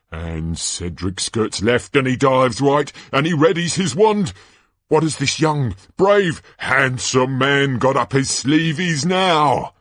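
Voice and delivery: high, swooping play-by-play; Ludo Bagman, excited commentator. Acted. Announcer following the champion's dodges, excited and showy. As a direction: relish every move for the crowd.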